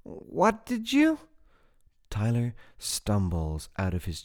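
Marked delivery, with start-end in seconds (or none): 0.0-1.2 1 shocked. A narrator's reading. Jane shocked continuing.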